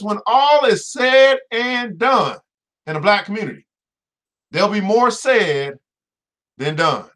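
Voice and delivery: "When all is said and done" drawn out, slowly; slow emphatic quote. Retold or imitated. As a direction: spell it out slowly for the back row. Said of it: "let me repeat that again".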